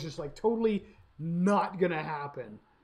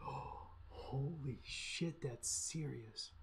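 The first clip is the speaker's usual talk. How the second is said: put-on awed kid; 4 semitones lower, 13 dB quieter, much slower than usual. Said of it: "And he was like".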